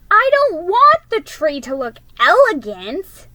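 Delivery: high, loud upset protest. Acted. Girl arguing with her sister about the tree, loud protest. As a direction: push back hard.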